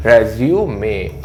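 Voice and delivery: रेज्यूमे said very slowly, "re-zi-u-me"; careful pronunciation. Shown as a demonstration. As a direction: spell रेज्यूमे out slowly by sound. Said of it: "क्या होता है ये?".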